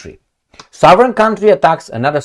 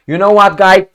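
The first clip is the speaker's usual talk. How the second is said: mock demand; 4 semitones higher, 4 dB louder than usual. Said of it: "I'm not gonna say".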